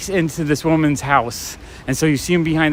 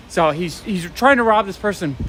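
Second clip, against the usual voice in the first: usual speaking voice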